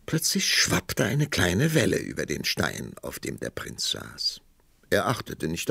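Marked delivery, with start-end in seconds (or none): none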